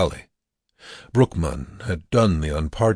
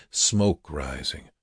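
usual speaking voice

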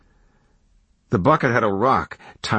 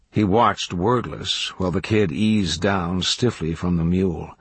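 ordinary delivery, nothing marked

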